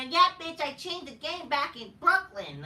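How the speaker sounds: high loud mock trash talk; mocking wrestler boast. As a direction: brag in a sneering caricature. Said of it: "You know".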